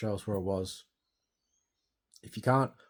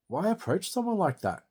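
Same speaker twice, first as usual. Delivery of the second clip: incredulous; 11 semitones higher, 4 dB louder than usual. Real, not acted. Outraged at police bashing his door.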